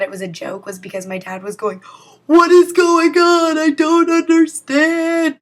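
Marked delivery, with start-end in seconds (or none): 2.3-5.4 put-on high, loud, slow bewildered old man; dad pretending confusion to the scammers; confused old man. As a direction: drag it out like a lost old man.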